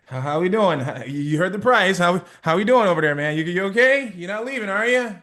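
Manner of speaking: loud, anxious checking in on the buyer; nervous seller. Retold or imitated. Seller worrying after naming the price, loud nervous check-in. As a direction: fret loudly that they'll walk away. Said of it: "You're like".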